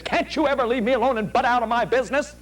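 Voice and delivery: fast, high-pitched irritated snapping; acted teen outburst. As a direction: play a fed-up teenager lashing out at mom. Acted adolescent snapping at parent.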